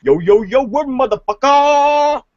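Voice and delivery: mock urban greeting. Hosts joking on a podcast, hammed-up street slang greeting. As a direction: stretch every syllable like a hammed-up street tough. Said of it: "I just straight got up racist".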